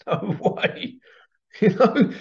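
real laugh breaking into the words; high, incredulous laughter while talking; laughing disbelief. Real, not acted. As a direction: crack up in disbelief. Laughs at the coincidence.

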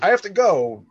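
a hint of mock-heroic urgency; Thor. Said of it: "where Thor's like".

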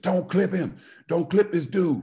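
high, loud warning; coach yelling. Retold or imitated. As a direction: bark the warning like a fired-up coach. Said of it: "this guy just screams at you".

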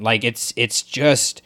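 usual speaking voice

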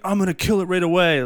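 imitating an overeager hero, pitched high; high-pitched pretend bravado; mock gung-ho. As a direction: put on a gung-ho movie character. Mimics an unbelievable horror character's reaction.

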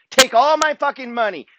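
pitched high and defiant; fired up. Real, not acted. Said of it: "Let me say it again".

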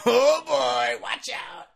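high hammed oh boy; mock excitement. Teasing listeners about his wild time, mock excitement. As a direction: tease a wild time ahead. Mock warning, wife is gone.

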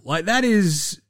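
usual speaking voice

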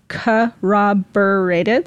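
corroborated drawn out slowly, syllable by syllable; slow, deliberate corroborated; slow careful pronunciation. Shown as a demonstration. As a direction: spell out corroborated slowly as written down. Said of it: "I actually have it written down".

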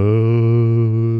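low drawn-out zombie moan. Retold or imitated. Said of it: "no matter what you're doing".

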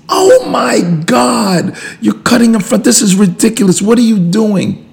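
imitating outraged driver. Friend raging at a car cutting in, high loud outburst. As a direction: wail at being cut off in traffic. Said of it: "in their outrageous anger".

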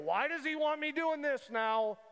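high, pushed-up whiny protest; exasperated complaint. Retold or imitated. Preacher voicing a believer who resents God's dealing, high and exasperated. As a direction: complain like someone fed up with God's timing. Frustrated complaint against God.

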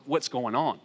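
ordinary talk

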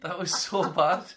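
slow and laughing; cracking up while teasing. Real, not acted. Laughing at a failed accent.

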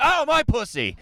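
high mock yell of pain; a sudden high yelp at the thud. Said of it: "You yelled?".

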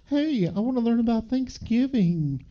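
high-pitched puppet voice; Yomi. Retold or imitated. Puppet asking to learn about Thanksgiving, high character voice. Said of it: "Yomi's like".

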